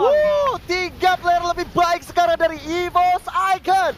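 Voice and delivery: high, loud hype commentary; excited co-caster. Acted. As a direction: ride the teamfight hype.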